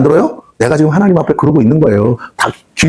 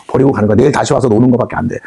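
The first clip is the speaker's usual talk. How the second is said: ordinary talk, nothing marked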